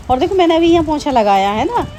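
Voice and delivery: look, look at all this water; excited showing. Real, not acted. Mother showing viewers the rain flooding the floor, excited.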